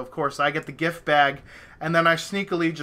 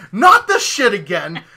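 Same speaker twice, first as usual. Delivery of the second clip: exasperation; 4 semitones higher, 9 dB louder than usual. Retold or imitated. Another wrong message appears.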